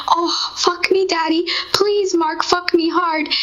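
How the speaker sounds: high, eager begging cry, still playful; breathless pleading. Retold or imitated. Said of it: "and I was going".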